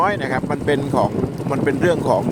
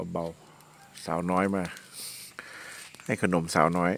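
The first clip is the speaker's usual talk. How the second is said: gentle coaxing; 6 semitones lower, 10 dB quieter, much slower than usual. Real, not acted.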